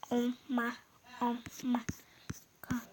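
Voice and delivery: ordinary delivery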